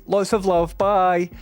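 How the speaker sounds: imitating a chipper farewell to the phone company; cheery sign-off. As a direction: chirp it like you're ending a call with a friend. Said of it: "I said cheerily".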